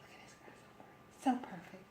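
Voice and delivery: charmed whisper; whisper, melting at it; overwhelmed by cuteness. Real, not acted. Cute grasshopper picture.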